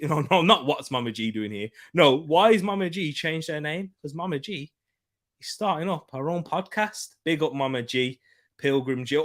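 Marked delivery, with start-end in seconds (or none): none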